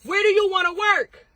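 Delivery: exasperated coach demanding an answer. Real, not acted. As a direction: push the question at her, almost yelling. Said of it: "Stop yelling at me".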